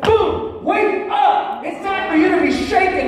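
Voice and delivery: high, shouted wake-up call; voice of the anointing. Acted. Preacher voicing the Spirit rousing sleepers, loud shout. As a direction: boom it out like a holy alarm. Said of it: "the anointing inside you says".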